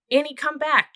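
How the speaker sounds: loud, high shout after her. A narrator's reading. Brother shouting as his sister runs off. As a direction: yell for her to come back. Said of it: "Jack shouted".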